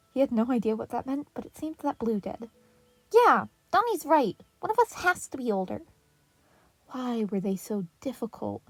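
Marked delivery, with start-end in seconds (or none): 3.1-5.8 put-on high, loud young boy; arguing kid. A narrator's reading. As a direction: pipe up like an eager little brother.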